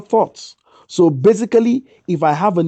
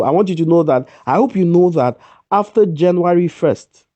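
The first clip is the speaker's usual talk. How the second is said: ordinary talk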